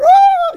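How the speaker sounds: high, loud screaming cheer; crowd scream. Retold or imitated. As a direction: scream like a thrilled fan.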